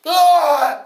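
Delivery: loud high scream of panic; terrified scream. Acted. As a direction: scream and bolt. Farmer screams and runs.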